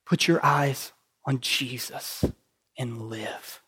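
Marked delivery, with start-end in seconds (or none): none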